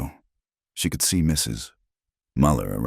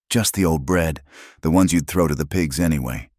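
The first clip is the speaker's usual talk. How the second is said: plain reading voice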